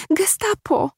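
bardzo wysoki, piskliwy głos; pisk. Acted. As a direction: pisnij to jak przerażona dziewczyna. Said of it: "pisnęła młodziutka pielęgniarka". (pielęgniarka piszczy na żołnierzy, bardzo wysoko)

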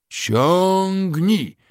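very high, loud single heave call; work shout. A narrator's reading. Crew hauling the locomotive, one loud heave call. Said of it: "w rytm okrzyków".

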